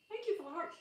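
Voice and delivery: talking while chewing; mouth full. Real, not acted. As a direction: speak around a bite of food. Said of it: "talk with my mouth full".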